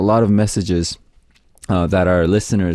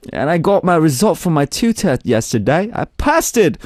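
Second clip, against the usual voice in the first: high-pitched put-on Australian voice; Aussie accent; 7 semitones higher than usual. Radio host reads a listener's exam news, Aussie accent. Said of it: "I'm gonna read this in Aussie accent".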